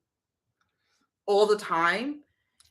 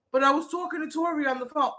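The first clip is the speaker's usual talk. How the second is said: mocking husband; 9 semitones higher, faster than usual. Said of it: "He was like".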